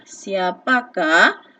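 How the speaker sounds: "siapakah?" slow with a rising end; teacher models the question word slowly; slow rising demo. Shown as a demonstration. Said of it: "ini adalah kata tanya".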